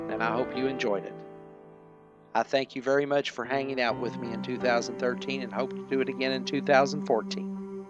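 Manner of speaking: ordinary talk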